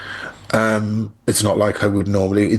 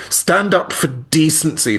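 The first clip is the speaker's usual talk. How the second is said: higher, slow, emphatic appeal; earnest appeal; 8 semitones higher, slower than usual. Retold or imitated. Retelling an indignant reaction to an interview, emphatic and high. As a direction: urge it in your own voice, drawn out.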